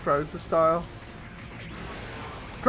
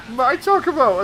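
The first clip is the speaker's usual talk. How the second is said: put-on toddler claiming her toy; 6 semitones higher, 6 dB louder than usual. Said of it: "She's like".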